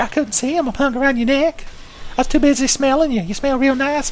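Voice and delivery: creepy high-pitched ghost voice; Gower. Acted. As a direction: play innocent as a creepy, high-voiced hanger-on. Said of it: "He's like".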